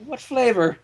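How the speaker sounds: usual speaking voice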